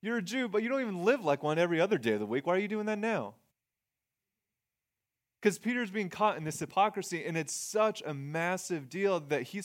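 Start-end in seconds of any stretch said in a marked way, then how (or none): 0.0-3.3 high-pitched, incredulous challenge; Paul confronting Peter. Acted. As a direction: call him out in a higher, disbelieving voice. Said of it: "And Paul says".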